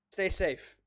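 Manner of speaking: ordinary talk, nothing marked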